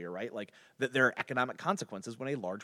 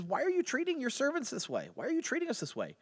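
higher, pleading protest; acted-out aggrieved appeal, raised in pitch; crying out in protest; 6 semitones higher, 5 dB louder than usual. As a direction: plead indignantly with the ruler. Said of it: "they went and cried out to Pharaoh".

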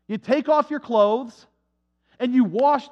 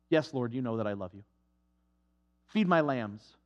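Peter's soft reply; 9 semitones lower, 6 dB quieter than usual. Acted. Peter answers Jesus on the shore, soft and low. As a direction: lower and softer, a touch hurt.

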